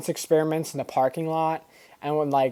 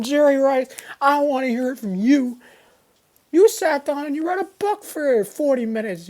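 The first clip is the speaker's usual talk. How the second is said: high-pitched mock tirade; hammed-up yelling; 9 semitones higher than usual. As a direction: rant indignantly in a much higher voice. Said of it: "yelling 'em out".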